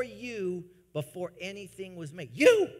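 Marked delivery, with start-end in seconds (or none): 2.3-2.7 sudden high, loud cry of you; a loud, high-pitched preacher's call; shouted you. Real, not acted. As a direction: fling the word out at the crowd. Preacher points at congregation.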